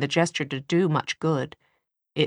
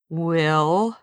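warning tone. A narrator's reading. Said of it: "he said in a warning tone".

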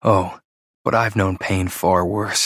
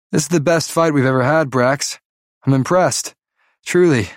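taunting; 6 semitones higher than usual. Acted. Kai taunts opponent mid-fight.

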